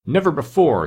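trembling rage. A narrator's reading. King crying out to Ak, understated rage. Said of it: "his voice trembled with rage".

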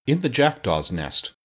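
plain reading voice

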